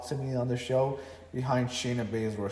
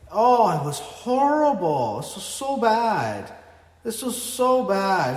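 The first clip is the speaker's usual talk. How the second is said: higher, louder and slow, drawn-out dismay; disgusted groan; 8 semitones higher, 6 dB louder, slower than usual. Real, not acted. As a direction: stretch the words out like it physically hurt. Ranting about the main event.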